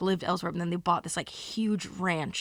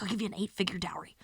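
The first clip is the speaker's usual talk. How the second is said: whispered confidential offer; hushed conspiratorial whisper; secretive whisper; 6 dB quieter, faster than usual. Retold or imitated. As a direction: lean in and whisper the deal.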